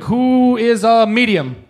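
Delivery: slow, loud call to the crowd; drawn-out shout to the room. Real, not acted. Calling out to crowd.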